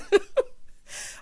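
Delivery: high, wheezy giggle; real laughing. Real, not acted. Host laughs before sharing Marlo's joke, high wheezy giggle. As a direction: lose it before the punchline.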